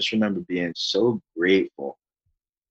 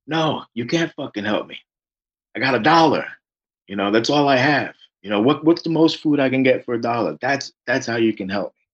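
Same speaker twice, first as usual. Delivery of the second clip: raised, higher and louder, exasperated retelling; frustrated outburst; 6 semitones higher, 6 dB louder than usual. Retold or imitated. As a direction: snap back at the clerk, fed up. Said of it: "I'm so frustrated".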